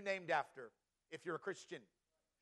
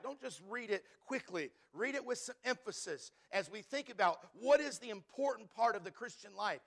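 normal talking voice

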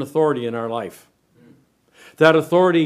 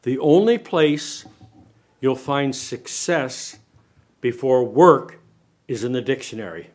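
slow and heavy, each phrase set apart by a pause; slow punchline said to the congregation; slow, weighty delivery. Shown as a demonstration. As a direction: let "The only place" sink in slowly before landing "dictionary". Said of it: "listen to this statement".

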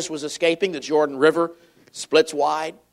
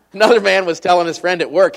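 normal talking voice

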